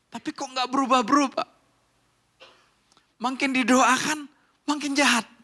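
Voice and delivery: high, loud complaint with punched phrase endings; exasperated congregant. Acted. Church member complaining that prayer backfires on the husband, exasperated. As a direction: protest in disbelief to the pastor. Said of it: "tapi seseorang ketika dia menceritakan".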